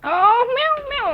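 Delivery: high playful meow; cat meow. Shown as a demonstration. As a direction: meow brightly for the child. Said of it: "like that".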